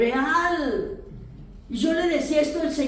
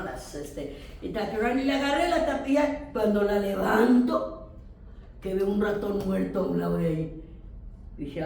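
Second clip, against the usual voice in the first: usual speaking voice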